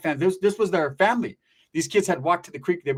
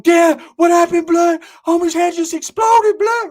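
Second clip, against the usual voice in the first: high, loud, stretched-out wail of disbelief; panicked shock; 14 semitones higher, 10 dB louder, slower than usual. Retold or imitated. Kids seeing their friend hit, high panicked yelling. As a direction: cry out high in hammed-up panic.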